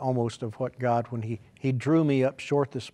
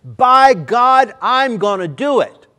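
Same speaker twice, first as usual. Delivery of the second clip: shouted high and loud; preacher thundering the vow at the congregation; thundered vow; 12 semitones higher, 16 dB louder, slower than usual. Shown as a demonstration. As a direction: roar it as a declaration. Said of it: "No, you don't understand".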